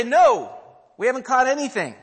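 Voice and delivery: loud, high answer shouted over water; calling back. Retold or imitated. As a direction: yell it back to the shore. Disciples answer back from boat.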